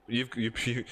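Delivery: ordinary delivery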